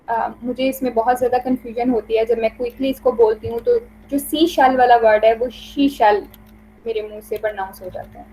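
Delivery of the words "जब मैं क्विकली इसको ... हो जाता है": normal talking voice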